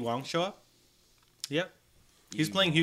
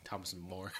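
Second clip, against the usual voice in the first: laughing; 6 semitones lower, 16 dB quieter than usual. Real, not acted. Laughing at his own slip.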